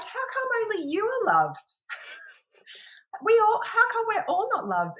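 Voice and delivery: put-on high, loud, indignant little girl; child's indignant voice. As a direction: whine like a jealous six-year-old. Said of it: "And she's like".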